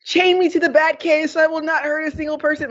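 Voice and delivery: high, sustained melodramatic plea; dramatic. Retold or imitated. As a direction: imitate a self-sacrificing heroine, hammed-up and high. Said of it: "she's very, like, dramatic".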